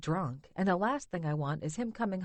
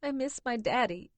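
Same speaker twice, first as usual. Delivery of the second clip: put-on higher, childlike tearful whimper; a little girl missing her father; young, crying; 5 semitones higher than usual. A narrator's reading. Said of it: "sounds so much younger".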